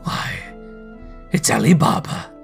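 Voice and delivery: loud, delighted cry of recognition; ecstatic exclamation. A narrator's reading. Scrooge spotting his boyhood storybook hero, ecstatic. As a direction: exclaim it with overflowing joy. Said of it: "Scrooge exclaimed in ecstasy".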